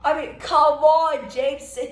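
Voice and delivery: high, slow pleading groan; exasperated plea. Real, not acted. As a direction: beg him in frustration. Pleading with Harden.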